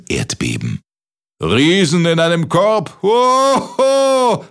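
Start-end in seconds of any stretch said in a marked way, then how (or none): 1.4-4.4 high, loud bellowed laughter; roaring giant laugh. Acted. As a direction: guffaw up at the sky. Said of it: "gröhlte der Riese in den Himmel".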